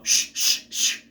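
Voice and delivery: forceful repeated "shh"; loud shushing. Acted. Shushing whispering pupils at school, loud shushes. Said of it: "That's louder than whatever noise".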